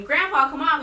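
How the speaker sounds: urgent call, acted out. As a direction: reenact hurrying grandpa along loudly. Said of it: "raising my voice".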